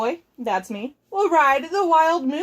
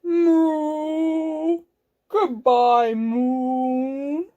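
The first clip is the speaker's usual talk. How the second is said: long drawn-out cow moo; 3 semitones higher, much slower than usual. Acted. Kidnapped cow bids the moon farewell, mooing.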